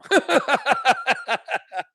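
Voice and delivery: a rising burst of laughter; high amused laugh. Real, not acted. A developer recounting how every site was redirected, high amused laugh.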